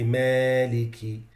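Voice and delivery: Malik with the alif tilted toward ya; wrong Malik with imala. Shown as a demonstration. Teacher showing students a wrong, tilted Malik. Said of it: "you can't say".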